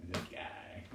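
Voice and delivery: unvoiced whisper to the cat; hushed aside; whispered this guy. Real, not acted. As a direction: whisper it like a secret. Pointing at the cat.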